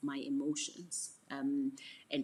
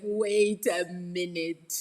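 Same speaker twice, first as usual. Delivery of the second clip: loud, very drawn-out mock realisation; slow, hammed-up objection; dawning indignation; 3 semitones higher, 11 dB louder, much slower than usual. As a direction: stretch it out loudly, as if it just hit you. Repeats her delayed reaction, hammed up.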